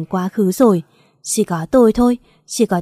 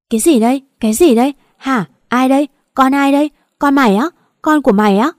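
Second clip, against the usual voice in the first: raised, louder burst of startled questions; shocked yell; 5 semitones higher, 5 dB louder, faster than usual. A narrator's reading. Friend discovers the baby in the car, shocked yelling. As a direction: fire off disbelieving questions in a lifted voice. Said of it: "rồi mới hét lên".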